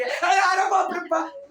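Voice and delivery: high, loud, drawn-out comic wail; mock alarm. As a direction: cry out in pretend panic. Soldado asustado ante el enemigo, actuado.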